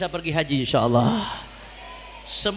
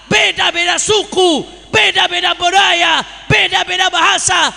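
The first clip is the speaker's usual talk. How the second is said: raised, loud sermon voice building to shouts; rising pulpit fervour, near shouting; fiery preaching; 10 semitones higher, 8 dB louder than usual. Acted. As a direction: rouse the crowd like a preacher at a rally.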